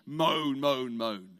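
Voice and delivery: low, droning mock moan. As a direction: groan the words out, sulking. Mock moaning sound.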